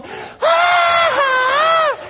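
high, loud hooting laugh imitation; wife's howling laugh. Preacher imitating his wife doubled over laughing in the road, loud high hooting ah ah ah. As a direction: mimic her wheezing ah ah ah laughter. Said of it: "she's going".

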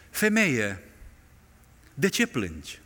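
soft, tender question; gentle angel voice. Acted. Angels asking weeping Mary why she cries, softly. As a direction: ask it gently, as comfort.

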